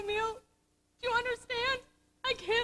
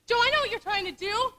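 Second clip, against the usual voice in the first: usual speaking voice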